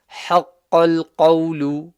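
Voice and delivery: حَقَّ الْقَوْلُ recited with the ha from the middle of the throat. Shown as a demonstration. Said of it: "middle of the throat".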